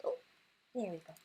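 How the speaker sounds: ordinary delivery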